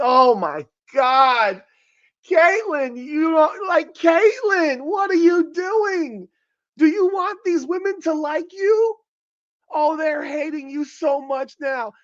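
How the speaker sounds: high, slow, pleading disbelief; exasperated disbelief. Real, not acted. Host pleading with Caitlin about her comment, exasperated and high. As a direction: drag out each plea like you can't believe her.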